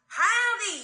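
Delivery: loud, high country holler; Gomer. Retold or imitated. Imitating the Andy Griffith Show character's greeting, loud country accent. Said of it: "you remember how Gomer used to talk?".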